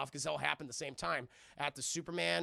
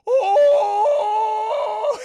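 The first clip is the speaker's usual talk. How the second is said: scared yelp; 17 dB louder than usual. Acted. Barry sees Superman coming.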